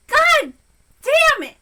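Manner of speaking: usual speaking voice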